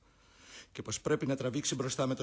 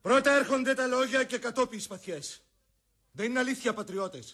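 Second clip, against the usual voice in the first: much higher, much louder public challenge; loud declaration; 10 semitones higher, 7 dB louder than usual. Acted. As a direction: proclaim it across the field. Brutus addresses enemy generals. (ο Βρούτος φωνάζει στους αντιπάλους στρατηγούς)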